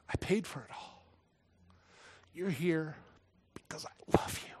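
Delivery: soft, halting, near-whispered comfort; tender reassurance. Retold or imitated. God welcomes a forgiven soul home, tender and hushed. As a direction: let each phrase land gently, with long pauses.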